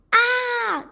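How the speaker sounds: high, loud answering scream; toad's startled scream. A narrator's reading. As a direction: scream right back in fright. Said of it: "the bumpy thing screams too".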